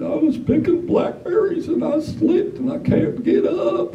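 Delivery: put-on high, slow, thin old man; high, slow voice of a helpless old man.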